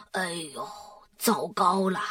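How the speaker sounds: soft, low murmur of dismay. A narrator's reading. Dad sees Dora and worries, quiet. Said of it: "爸爸小声地说".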